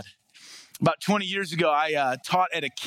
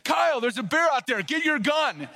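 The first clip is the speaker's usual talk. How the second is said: high-pitched mock alarm yell; retold yell; 8 semitones higher than usual. Young camper running in to warn the ranger, retold high yell. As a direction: imitate a frightened shout, pitched well up. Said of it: "all he yelled was".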